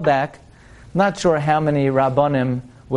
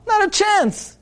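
"Not a chance" flung up high in triumph; emphatic refusal; slower than usual. Acted. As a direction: crow it, no way you're getting it. Butcher refusing, worked up.

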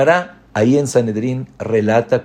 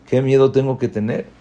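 ordinary talk

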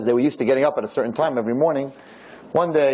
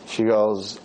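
ordinary talk

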